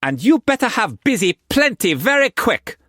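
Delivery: put-on high, loud playful young woman; Mei Mei's voice. A narrator's reading. Mistress teasing Struan in bed, high playful voice. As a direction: tease him in a high bright voice.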